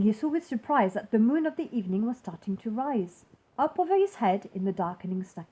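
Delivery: plain reading voice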